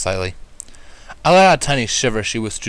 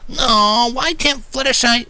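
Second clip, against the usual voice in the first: reluctant protest; 3 dB louder, much slower than usual. A narrator's reading. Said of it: "Rainbow started before".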